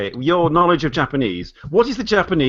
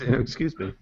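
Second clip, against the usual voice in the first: a hint of a politely apologetic hobo, softer; 3 semitones lower, 7 dB quieter than usual. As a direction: lightly voice a courteous vagrant. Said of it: "Even, even the hobos are polite".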